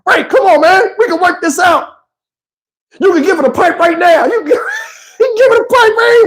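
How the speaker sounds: high, laughing, pleading imitation of Terrence Howard; panicked Terrence Howard. Terrence Howard begging blind Ray to stay, high laughing plea. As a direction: beg Ray to come back, cracking up. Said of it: "Terrence Howard was like".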